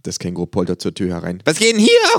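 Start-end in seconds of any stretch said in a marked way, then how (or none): 1.4-2.2 loud, high-pitched kangaroo holler; called out. A narrator's reading. Kangaroo bursting through the door, loud high call. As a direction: burst in calling at full voice. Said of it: "ruft es".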